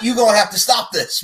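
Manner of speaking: high, loud mock exasperation; exasperated protest. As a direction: plead loudly for an end to it. Acting out his exasperated reaction.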